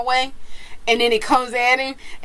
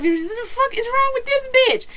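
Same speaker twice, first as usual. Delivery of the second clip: dog's inner voice. Retold or imitated. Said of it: "My dog was like".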